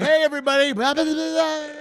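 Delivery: loud, high mock crowd call; hyped-up stage singer. Joking that he would fake every song on stage, hyped-up high shout. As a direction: belt out filler as if working a crowd. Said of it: "That would be me with every song".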